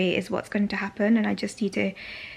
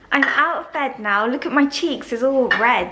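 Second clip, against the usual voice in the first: brighter, louder and cheerful; upbeat; 4 semitones higher, 6 dB louder than usual. Real, not acted. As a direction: perk right up for the camera. Perks up, new scene.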